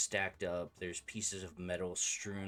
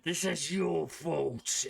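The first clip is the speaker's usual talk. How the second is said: loud, high, slow tormented roar; large booming voice; 9 semitones higher, 5 dB louder, much slower than usual. Acted. Massive metal form crying out in the darkness, loud anguished bellow. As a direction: bellow it slowly like a huge tormented creature. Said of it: "a large voice that goes".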